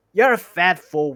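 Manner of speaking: loud, high sneering troll; troll insult. Acted. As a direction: snarl an insult at his mate.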